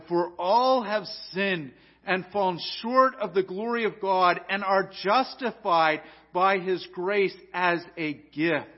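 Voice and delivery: loud, high proclamation of scripture; fervent preaching. Acted. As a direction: proclaim the verse with full force. Reads the verse at full force.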